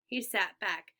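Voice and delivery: ordinary delivery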